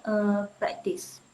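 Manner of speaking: usual speaking voice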